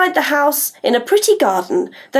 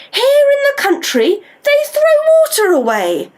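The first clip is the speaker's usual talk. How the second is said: put-on high girl voice; 13 semitones higher than usual. Molly's line, voiced high.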